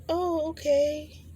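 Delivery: very high, slow, knowing oh okay; sarcastic okay. Retold or imitated. Reacting to the copied video topic, high slow sarcasm. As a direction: drag it out, unimpressed.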